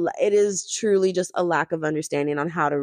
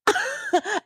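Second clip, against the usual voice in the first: high laugh; 14 semitones higher than usual. Real, not acted. Laughing at the absurd situation.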